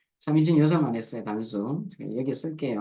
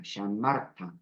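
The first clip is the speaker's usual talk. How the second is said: "샤마르타"의 둘째 음절 "마"에 힘을 주고 끝을 내림; "샤마르타", "마"를 강하게; 5 semitones lower, 3 dB quieter, slower than usual. Shown as a demonstration. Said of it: "종음절에 가지 않고 두 번째 음절에 갑니다". (둘째 음절 강세 시범)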